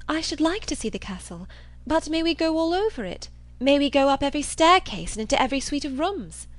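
high, lilting hopeful questions; tempted, with growing eagerness; eager Catherine. Acted.

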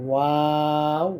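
ওয়াও with rounded lips, drawn out slowly; rounded and held long. Shown as a demonstration. Teacher showing viewers the rounded mouth, long and held. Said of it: "মুখ গোল হবে".

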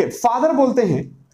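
normal talking voice

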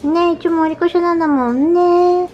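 high, sing-song praise for a good kitty; pet praise. Real, not acted. Owner praising her cats at dinner, high cooing voice. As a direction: coo at the cats like they're babies.

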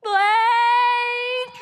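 loud, high panicked shout of a name; desperate scream into the rubble; frantic call. Acted. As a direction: yell for your lost child. Mother searching for her son.

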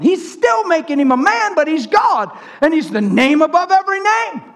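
high, exultant sermon cry; fervent preaching. Acted. Preacher proclaiming the name above every name, fervent preaching.

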